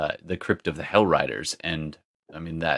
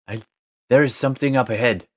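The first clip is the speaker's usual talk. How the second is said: louder, higher, hurried bad French accent; terrible French accent; 4 semitones higher, 5 dB louder than usual. [name] calling out to Darmida, terrible French accent. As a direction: call out in a badly faked French accent. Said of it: "that's a terrible French accent".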